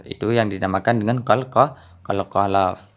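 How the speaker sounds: ordinary talk, nothing marked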